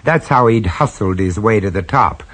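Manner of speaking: low-key, wry first-person narration; lightly amused storyteller; story narration. A narrator's reading. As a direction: confide it to the listener.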